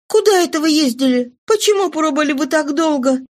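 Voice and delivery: put-on high young girlish voice, hurt and scolding; a daughter chiding her father for leaving; Cosette's reproach. A narrator's reading. Cosette scolds her returned father, high, reproachful.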